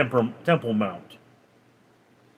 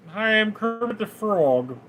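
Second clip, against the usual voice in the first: a slightly higher, half-hearted frog puppet voice; lightly voiced Kermit the Frog, admittedly bad; Kermit impression; 5 semitones higher than usual. Shown as a demonstration. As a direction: toss off a quick, not very good Kermit. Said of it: "Never do a good Kermit".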